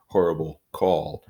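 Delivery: plain reading voice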